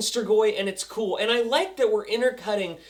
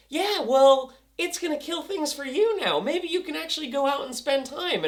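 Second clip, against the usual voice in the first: lightly voiced coaxing mother, higher; a hint of a higher-pitched mom voice; imitación de Kelly; 6 semitones higher than usual.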